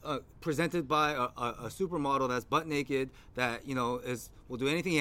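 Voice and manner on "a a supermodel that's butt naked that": ordinary delivery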